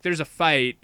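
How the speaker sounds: ordinary delivery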